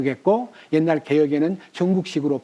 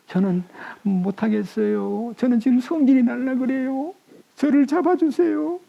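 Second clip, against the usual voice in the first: rising higher, begging to be held back; pleading prayer; 8 semitones higher than usual. Shown as a demonstration. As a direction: near losing temper, plead for help. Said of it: "이렇게 막아놓고". (점점 높아지는 간절한 호소)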